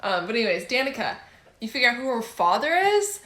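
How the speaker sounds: normal talking voice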